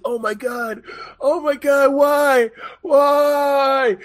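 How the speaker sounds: fake-sob it like a rider lying on the ground; mock despair. Rider collapses at finish line.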